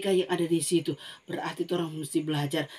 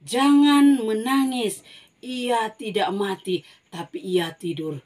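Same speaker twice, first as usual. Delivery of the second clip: slow, louder opening, then gentle; comforting Jesus; 2 semitones higher, 4 dB louder, slower than usual. Acted. Jesus calms the weeping mourners. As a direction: soothe the mourners slowly. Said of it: "Tuhan Yesus bilang begini".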